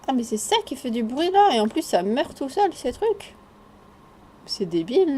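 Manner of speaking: ordinary delivery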